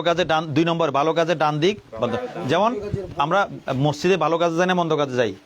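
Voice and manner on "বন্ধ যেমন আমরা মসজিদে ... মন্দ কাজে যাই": normal talking voice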